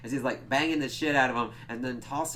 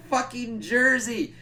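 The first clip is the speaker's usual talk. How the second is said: mock angry yell; 12 semitones higher, 6 dB louder, slower than usual. [name] voiced, shouting.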